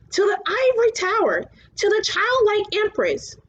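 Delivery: high dragon voice; luck dragon voice. A narrator's reading. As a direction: lift the pitch to play the dragon. Falkor voiced by narrator.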